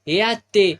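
ए आत्ते called out loud with the stress on आत्ते. Acted. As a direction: call her again, leaning on आत्ते. Calling the old woman again. (आत्ते वर जोर देत पुन्हा मोठी हाक)

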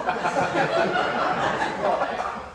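breathy laugh. Acted.

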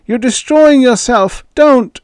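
put-on high, loud old man's cry; croaking old man. A narrator's reading. Ewing warning Joey off, high and loud. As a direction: rasp out a desperate warning.

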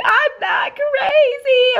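high, drawn-out wail; hammed-up high-pitched whine; imitating indignant child. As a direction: protest slowly like an offended little boy. Offended child protests in story.

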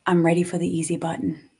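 ordinary talk, nothing marked

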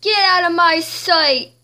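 queen orders her rival out; angry shout. Acted.

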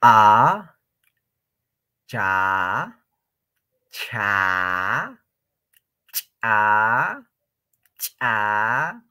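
A, cha, cha, cha, cha drawn out slowly with a rising glide on each; exaggerated syllables. Shown as a demonstration. Teacher drilling the class, exaggerated syllables. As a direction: overdo each cha so students hear it. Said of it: "yo estoy exagerando para que me entien".

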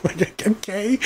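laughing okay that lifts up high; a laugh breaking into the okay; amused laugh. Real, not acted. Viewer laughing at the A-Team's fake gunfights.

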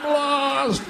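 high, wailing yowl of a lost cat; old cat wandering the house at night, yowling; caterwauling cat. Retold or imitated. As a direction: wail it like a demented old cat.

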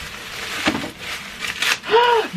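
high breathless gasp; surprised gasp. Real, not acted. Gasp on seeing the soap.

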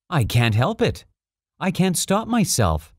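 high-voiced, embarrassed little prince. A narrator's reading. Little prince excusing his yawn to the king, mildly embarrassed. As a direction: own up with mild embarrassment, pitched high. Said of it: "thoroughly embarrassed".

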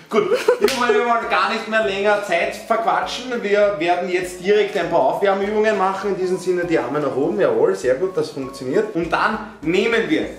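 mock tutorial host. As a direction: play a cheesy fitness host.

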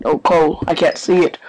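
ordinary talk

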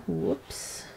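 normal talking voice

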